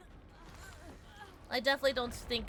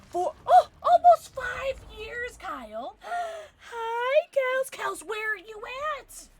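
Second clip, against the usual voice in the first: high, drawn-out squeal of delight; excited greeting; 13 semitones higher, 8 dB louder, much slower than usual. Real, not acted. Streamer spotting friend Kels in chat, squealing excitedly.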